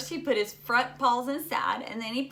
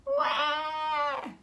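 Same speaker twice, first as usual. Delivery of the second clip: put-on high babyish wail; imitated infant crying; mock baby wail; 6 semitones higher than usual. A narrator's reading. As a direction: cry out like a little baby.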